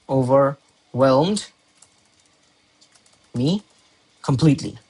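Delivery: "Overwhelmed" very slow, split into parts; "Overwhelmed" stretched out as if writing along; slow dictation. Shown as a demonstration. Dictating the word while writing.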